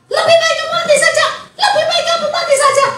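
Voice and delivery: very high fast squawking parrot. Acted. Unrepentant parrot still squawking at home, high. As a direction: screech it like a parrot. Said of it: "Yang di rumah tetap".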